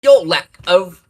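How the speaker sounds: slow, loud, high mock British villain voice; cheesy British Vader. Mocking the original Vader actor, in a cheesy British accent. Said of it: "you have Darth Vader going".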